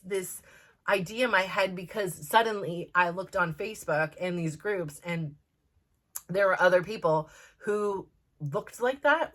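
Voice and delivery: ordinary talk, nothing marked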